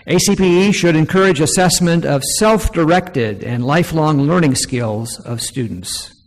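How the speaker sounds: loud, projected reading of a formal statement; formal read-aloud. Real, not acted. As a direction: read it out firmly to a room. Reads numbered recommendation aloud to audience.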